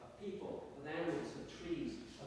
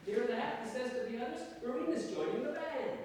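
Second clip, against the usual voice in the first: higher, louder call to bandmates; 9 semitones higher, 5 dB louder than usual. Acted. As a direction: announce it to the others, raised voice. Musician calls to his bandmates.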